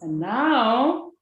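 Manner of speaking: ordinary talk, nothing marked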